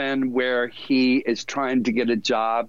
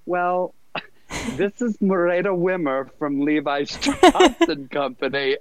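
amused recruiter; 5 semitones higher than usual. Retold or imitated. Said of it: "she goes".

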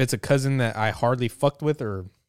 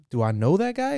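mock puzzled; 7 semitones higher than usual. Feigning not knowing Mike.

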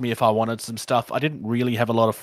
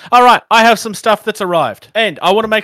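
excited announcement; 8 semitones higher, 6 dB louder than usual. Real, not acted. Excited show and tell.

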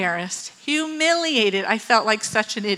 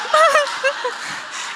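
high-pitched imitated wail; hammed-up sob sound; mock bawling. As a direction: fake a long crying whine. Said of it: "I'm, just, like, bawling".

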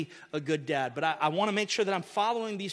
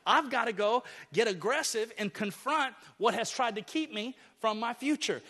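ordinary talk, nothing marked

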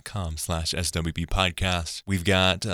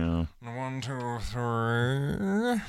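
Penguin voice; 5 semitones higher, much slower than usual. Shown as a demonstration. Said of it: "Sounds like the Penguin".

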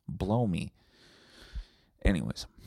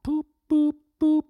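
boop noises; 8 dB louder than usual. Retold or imitated. Imitating shuffle button sounds.

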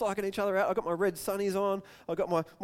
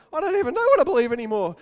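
exasperated outburst; 6 semitones higher, 8 dB louder, faster than usual. Retold or imitated. Voicing a shaken believer.

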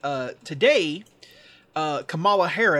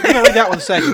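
laughing; 4 semitones higher, 10 dB louder, faster than usual. Real, not acted. Flustered after wrong tweet.

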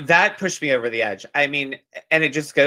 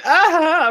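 squealed high ah; a high, dramatic squeal; 5 dB louder than usual. Retold or imitated. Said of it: "Paul was like".

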